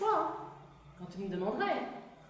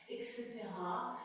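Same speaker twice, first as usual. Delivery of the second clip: repeat, softer each time until gone; fading out; 3 semitones lower, 12 dB quieter than usual. Pretend. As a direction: let it trail away to nothing.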